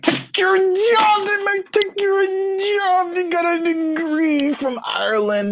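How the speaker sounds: very high strained parody voice; mock outraged yell. Warning would-be influencers about graduates, high parody outrage. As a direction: squawk it out, drawn out and high. Parody of angry job-loss cry.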